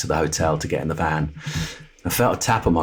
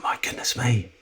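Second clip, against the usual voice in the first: whispered, softer and higher; awed whisper; 6 semitones higher, 6 dB quieter than usual. Retold or imitated. Realising the stranger vanished, awed whisper. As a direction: breathe it out in astonishment.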